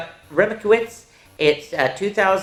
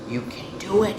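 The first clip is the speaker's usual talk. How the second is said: whispered; 3 semitones higher, 6 dB quieter than usual. Acted. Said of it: "he whispered".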